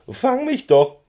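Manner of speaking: high, loud cheeky pony cry; cheeky teasing call. A narrator's reading. Keks taunts Schoko to chase him, high cheeky call. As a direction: tease him and dart away, calling out high. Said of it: "grinste ihn frech an".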